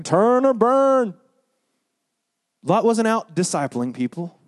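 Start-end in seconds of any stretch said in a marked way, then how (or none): 0.0-1.1 high, very drawn-out street call; mock megaphone preacher. Imitating a street preacher with a megaphone, slow high holler. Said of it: "on the street with a megaphone saying".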